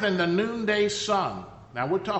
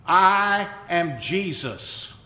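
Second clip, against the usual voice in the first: very slow, weighty reveal; voice of Jesus; 3 dB louder, much slower than usual. Acted. The voice answers Saul's question, slow and solemn. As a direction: drop each word slowly and solemnly. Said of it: "And the voice said".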